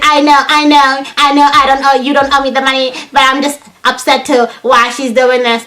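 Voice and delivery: put-on high frustrated voice; imitated upset roommate. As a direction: imitate her insisting she's just upset. Mimicking roommate upset over money.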